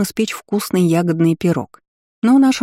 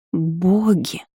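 gasped. A narrator's reading. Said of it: "судорожно вздохнула Инесса".

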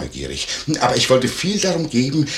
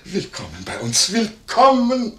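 effusive welcome; 6 semitones higher than usual. Acted. Landlord welcoming Paul Werner to his house, effusive. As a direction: sing out the final greeting, high and long.

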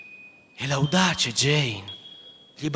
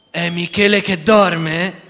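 eager question; 3 semitones higher, 8 dB louder, slower than usual. Acted. Peter curious about sleeping child.

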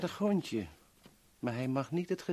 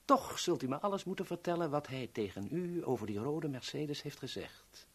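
normal talking voice

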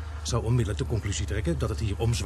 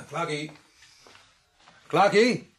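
louder, higher call across the house; 4 semitones higher, 4 dB louder, much slower than usual. Acted. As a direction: shout his name, growing worried. Searching for Clark. (steeds luider roepen)

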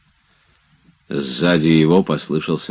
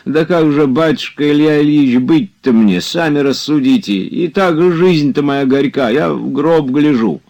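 a lightly voiced old servant, higher and pleading; old servant Zakhar pleading; 11 semitones higher, 5 dB louder than usual. A narrator's reading. Old servant Zakhar begging his master not to argue, pleading.

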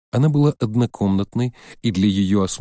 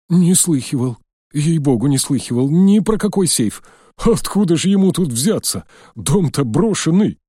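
put-on high, loud pleading old man; frightened old man; 7 semitones higher, 4 dB louder than usual. A narrator's reading. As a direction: swear innocence like a scared old man.

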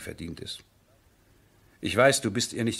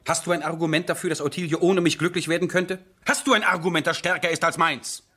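rising, urgent challenge, higher and louder; passionate pressing; 7 semitones higher, 4 dB louder than usual. Acted. Eduard demanding his friend's best argument, rising and urgent. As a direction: push him hard for an answer.

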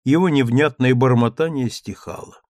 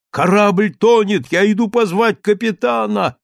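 put-on deranged old man; 8 semitones higher, 5 dB louder than usual. A narrator's reading. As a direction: shout it as if the ship is sinking. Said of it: "явно обезумев".

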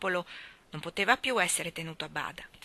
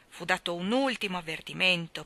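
plain reading voice

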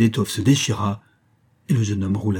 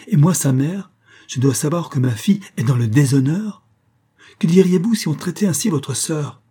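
outraged mother; 6 semitones higher, 4 dB louder than usual. Acted. Outraged mother confronts count.

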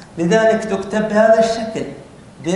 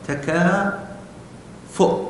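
تكافؤ said very slowly and softly; slow dictation; 6 dB quieter, much slower than usual. Shown as a demonstration. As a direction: draw out تكافؤ as you write it. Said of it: "سأرسمها على واو". (تكافؤ ببطء وهدوء كأنك تملي)

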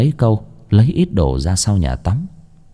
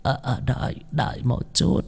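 put-on soft, halting, higher old crone voice; doddering old woman; 4 semitones higher, 11 dB quieter than usual. A narrator's reading. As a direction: answer faintly like a frail old granny. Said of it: "một bà cụ lụ khụ vang lên".

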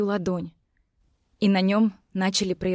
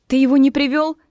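desperate; 5 semitones higher, 6 dB louder than usual. Acted. Heroine desperate for the healer.

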